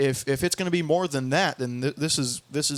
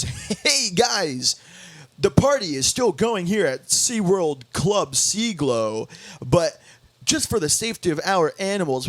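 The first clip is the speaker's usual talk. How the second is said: upbeat club DJ announcement, stretched singsong swings; cheery DJ working the crowd, punching the good news; happy guy DJ. Retold or imitated. DJ telling the crowd fireworks are canceled, happy guy DJ. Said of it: "happy guy D J".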